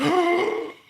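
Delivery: high, loud collective gasp; shocked gasp. Retold or imitated. Crowd hearing the news, gasping high and loud. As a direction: gasp as if stunned. Everyone reacts to the story.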